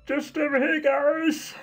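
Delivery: high, put-on nervous babble; hammed-up awkward laugh into a feeble excuse; mock awkward laughter. Player mimicking the heroine caught snooping, hammed-up awkwardness. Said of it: "I laugh awkwardly as I".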